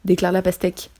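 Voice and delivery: ordinary delivery, nothing marked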